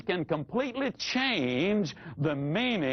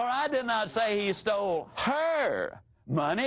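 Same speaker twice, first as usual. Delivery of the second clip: "her" pitched high and held long; stress on HER; 4 semitones higher than usual. Shown as a demonstration. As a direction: punch and stretch "her" before "money". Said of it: "It was hers that the rascal stole".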